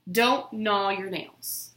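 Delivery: plain reading voice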